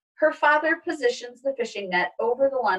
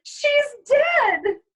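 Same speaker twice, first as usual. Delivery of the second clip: much higher, loud anguished scream; 6 dB louder than usual. Acted. Izzy seeing the possum, loud anguished scream. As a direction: shriek in horror. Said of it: "Izzy screams".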